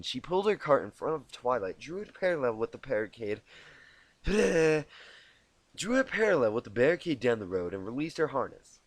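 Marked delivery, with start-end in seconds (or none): none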